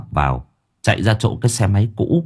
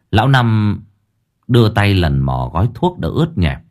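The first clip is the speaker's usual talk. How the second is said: usual speaking voice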